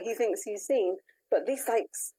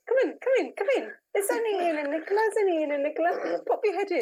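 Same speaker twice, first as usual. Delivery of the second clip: laughing coax; 6 semitones higher, faster than usual. Real, not acted. Coaxing Gary on camera, laughing.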